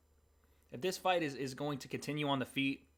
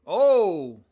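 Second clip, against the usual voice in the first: startled shout; 5 semitones higher, 15 dB louder than usual. Real, not acted. Reacting to a close punch.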